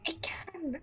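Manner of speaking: whispering on the phone. Real, not acted. Hiding, insisting it can hear.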